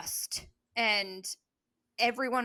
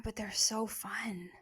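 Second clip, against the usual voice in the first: slow conspiratorial whisper; 7 dB quieter, slower than usual. Pretend. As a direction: whisper it like a secret. Whispered naughty aside.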